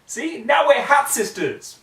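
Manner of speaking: overjoyed unicorn squeal; gleeful. Acted. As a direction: beam over matching hats. Twilight overjoyed with hats.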